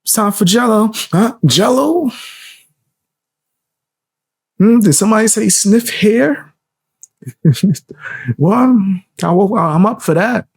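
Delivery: high-pitched mock old politician, halting; doddering zombie politician. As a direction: play a dazed candidate, pausing between thoughts. Acts out the zombie candidate.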